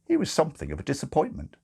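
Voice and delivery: ordinary delivery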